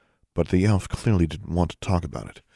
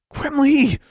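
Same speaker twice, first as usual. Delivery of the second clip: sharp high hissed call; hissed name; 7 dB louder than usual. A narrator's reading. Girl hissing at the elf in the bath. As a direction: hiss his name sharply. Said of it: "she hissed".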